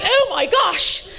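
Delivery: high, slow scandalised reaction; mock shock. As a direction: imitate an audience aghast. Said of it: "I thought that would get a gasp".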